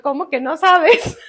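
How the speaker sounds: loud, slow disbelief edged with a laugh; retold disbelief. Mother questioning her feverish son, incredulous.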